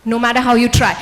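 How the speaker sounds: ordinary talk, nothing marked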